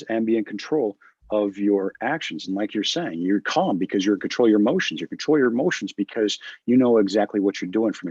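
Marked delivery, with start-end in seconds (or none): none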